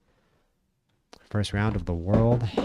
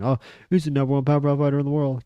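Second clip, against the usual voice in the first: fast, higher, gushing fan impression; mocking fan hype; 6 semitones higher, 5 dB louder, faster than usual. Mocking fans hyping Crawford after a win, fast and higher. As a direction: rattle it off like a fan after every big win. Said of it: "And they say that about Crawford, too".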